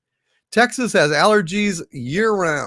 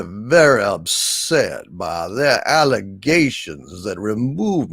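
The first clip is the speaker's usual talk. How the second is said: Kevin Spacey; 5 semitones lower than usual. Acted. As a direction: play a low-pitched, scheming House of Cards monologue. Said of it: "This is my impression of Kevin Spacey".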